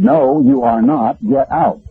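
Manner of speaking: high, slow and emphatic no, then a curt dismissal; firm refusal. Retold or imitated. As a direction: shut him down hard, spacing the words out. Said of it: "I said".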